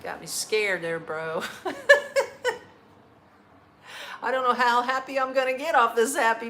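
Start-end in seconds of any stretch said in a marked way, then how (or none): 1.5-2.6 loud high laugh. Real, not acted. Host cracks up at a viewer's pizza joke, loud laughing.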